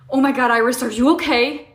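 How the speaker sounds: ordinary talk, nothing marked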